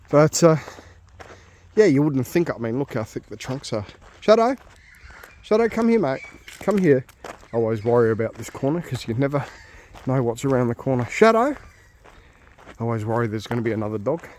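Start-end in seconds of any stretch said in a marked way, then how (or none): 4.2-7.0 calling the dog. Real, not acted. 11.0-11.5 calling the dog. Real, not acted.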